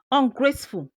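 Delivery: usual speaking voice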